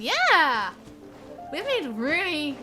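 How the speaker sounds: normal talking voice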